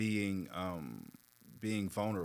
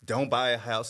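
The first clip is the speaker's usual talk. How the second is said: quoting grandparents' warning; 2 semitones higher, 8 dB louder than usual. Retold or imitated. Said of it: "told you".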